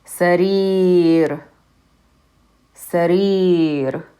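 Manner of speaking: Sarir with a long drawn ee in the last syllable; long E demo. Shown as a demonstration. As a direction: stretch the ee in Sarir. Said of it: "That long vowel is the E sound".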